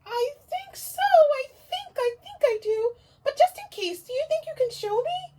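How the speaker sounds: high, loud, eager little owl; puppet owl. Acted. Owl puppet greeting the teacher, high and eager. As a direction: greet like a thrilled puppet friend.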